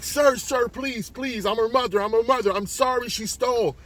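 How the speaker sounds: high-pitched, urgent begging; desperate mother pleading. Acted. Mother begs for her daughter.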